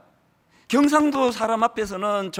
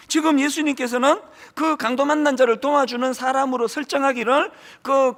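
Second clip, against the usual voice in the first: ordinary talk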